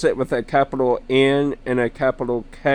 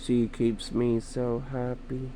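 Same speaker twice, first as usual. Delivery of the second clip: soft musing; 11 dB quieter than usual. Real, not acted. Dreamy musing about Nighthawk.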